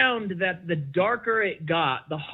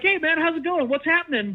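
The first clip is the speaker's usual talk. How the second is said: higher, sing-song fake cheer; mock cheerful; 11 semitones higher than usual. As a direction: parody the breezy text. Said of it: "this cheerful thing".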